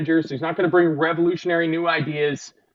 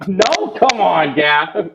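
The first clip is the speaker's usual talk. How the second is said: playful protest; 3 semitones higher, 7 dB louder, much slower than usual. Real, not acted. Teasing friend who refused.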